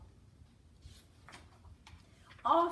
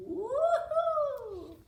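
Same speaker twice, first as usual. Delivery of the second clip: joyful whoop; 9 dB louder than usual. Acted. Sliding down the hill.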